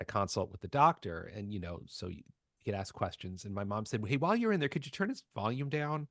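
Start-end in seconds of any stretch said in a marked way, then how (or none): 4.1-6.1 higher-pitched mimicked mom; mom's request. Mom asking the doctor about her loud kid, mimicked mother.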